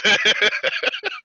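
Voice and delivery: loud, high burst of laughter; hearty high laugh; real laughter. Real, not acted. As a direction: laugh hard at your own joke. Laughs at own Texas heat joke.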